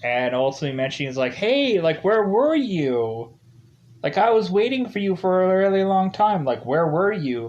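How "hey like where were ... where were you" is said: higher-pitched, mock put-out complaint; acted reproach. Kathleen emailing the date who never came, reproachful. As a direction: stretch out the demanding question, pitched up.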